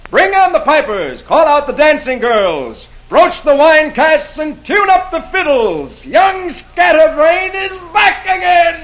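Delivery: high, slow jubilant shout. Acted. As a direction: bellow it out with glee. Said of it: "But Gawain shouts".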